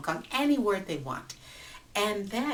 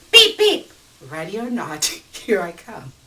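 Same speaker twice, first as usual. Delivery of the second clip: bold horn call; 3 semitones higher, 14 dB louder than usual. Acted. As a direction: blast the horn and barge in. Baba forces into traffic.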